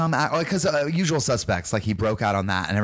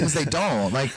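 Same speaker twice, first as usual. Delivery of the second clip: ordinary delivery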